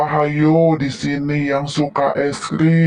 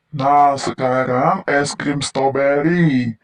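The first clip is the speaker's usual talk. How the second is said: ordinary talk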